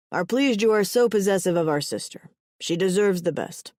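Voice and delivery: hearty, declaimed approval; announcing. A narrator's reading. Brother approves of his sister's mate, announcing. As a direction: proclaim it on the family's behalf. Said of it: "Pashov announces".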